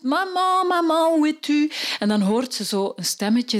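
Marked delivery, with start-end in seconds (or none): 0.0-1.7 put-on high, calling child's voice. Girl searching the woods calls for her lost mama. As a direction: call out for mama, high and carrying. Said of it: "ze roept".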